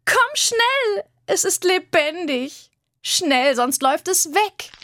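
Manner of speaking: put-on high, urgent girl's voice calling out; excited calling girl. Girl calling her brother to come see the creature, high and urgent. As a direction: call the boys over, breathless with excitement.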